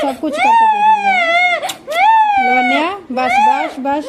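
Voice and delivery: very high, loud hush over the crying. Real, not acted. Mother hushes crying baby.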